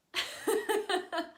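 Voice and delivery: high, loud burst of laughter. Real, not acted. Teacher amused by own tone example, loud high laugh.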